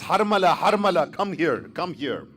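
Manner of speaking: very loud, high bark that fades; shouted command. Acted. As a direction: summon the archer across the army.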